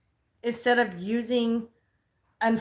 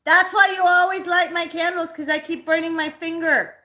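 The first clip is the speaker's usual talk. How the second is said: loud, higher exasperated complaint; exasperated shout; 7 semitones higher, 7 dB louder than usual. Real, not acted. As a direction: shout it out, fed up and stung. Complains after burning finger.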